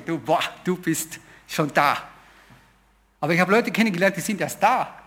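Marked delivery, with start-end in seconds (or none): 0.0-2.0 slow, marvelling praise; awed admiration. Retold or imitated. Speaker to his role model, slow and awed. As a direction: breathe it out in wonder, slowly.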